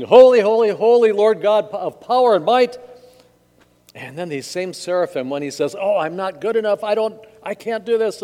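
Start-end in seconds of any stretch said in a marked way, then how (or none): 0.0-2.8 loud, high acclamation; exultant proclamation. Acted. Flaming seraphim praise God, loud exultant cry. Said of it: "are singing". 5.8-8.2 high, fretful excuse-making; mock distressed protest. Isaiah shrinks from God's calling, high protest.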